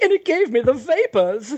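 a laughing, hammed-up Southern belle swooning; southern belle accent. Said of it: "I should have read that in a southern accent".

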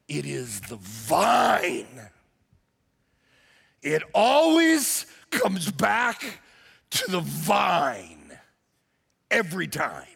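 very slow, low, drawn-out proclamation. Acted. Pastor restating the vine theme, slow low emphasis. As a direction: let the theme land slowly.

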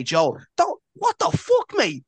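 a hint of Joel losing it at his brother; mock Joel outburst. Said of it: "the way he's just trying to say".

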